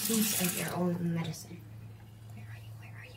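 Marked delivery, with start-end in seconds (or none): 2.3-3.2 whispering, searching for the bug; barely voiced murmur; hushed whisper. Real, not acted. Hunting the bug quietly.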